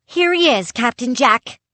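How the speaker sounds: high, loud and eager; eager kid reporting back; excited boy. A narrator's reading.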